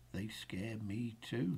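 unvoiced soft whisper. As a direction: whisper it like a secret. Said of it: "laughed his friend".

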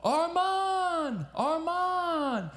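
calling a name at the top of his voice, high; father shouting his son's name down into the rubble; shouted name. Retold or imitated. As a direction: yell the name desperately into a hole in the rubble. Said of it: "he yells out".

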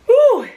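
high sharp exhale whew; burning mouth whew. Real, not acted. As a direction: blow out the burn, loud and high. Exhale after hot chili bite.

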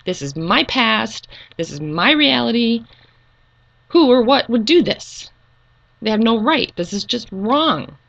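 venting outrage at whoever changed her past; really mad. Retold or imitated. Raging alone about her altered memories, furious. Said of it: "really mad one day".

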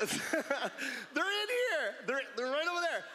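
giggling through the words; words broken by laughter; laughing while talking. Real, not acted. Laughing while pointing at guys.

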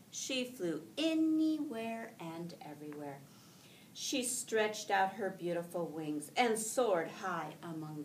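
lively storybook read-aloud with voiced ducks; reading to children. Teacher reading a picture book aloud to her class, lively and gentle.

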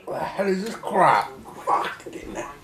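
grimacing through sour candy; eating sour candy. Real, not acted. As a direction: talk with sour candy in mouth. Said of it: "That is awful".

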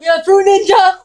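loud, high excited guess; excited shout. Real, not acted. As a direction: blurt it out with excitement. Confirming game excitedly.

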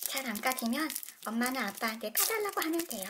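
ordinary talk, nothing marked

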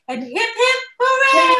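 high, loud cheer; full-throated hip hip hooray; cheering shout. Real, not acted. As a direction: lead the crowd in a big hooray. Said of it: "One more time".

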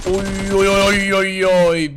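loud, rapid burst of oy calls; startled cries. Real, not acted. Streamer yelps to himself under sudden fire, loud alarmed oy cries. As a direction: yelp in alarm as the shots hit.

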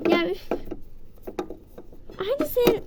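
normal talking voice

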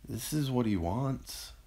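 high, slow, choked-back tears; sobbed. A narrator's reading. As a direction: hold back tears while insisting. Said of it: "Gavin sobbed".

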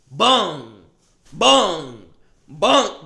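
loud thudding bung noises; punch sound effects. Retold or imitated. Punching the choker, loud bung bungs. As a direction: hammer each bung like a blow.

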